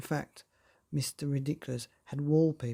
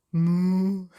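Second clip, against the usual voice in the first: loud mock moo; cow moo; 4 semitones higher, 9 dB louder than usual. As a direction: belt out a silly cow moo. Pig voiced mooing.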